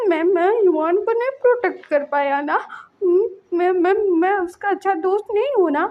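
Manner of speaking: put-on high stammering child voice, upset; a boy choking on guilt; child voice, guilt-stricken and stammering; tearful child. Child blames himself, crying.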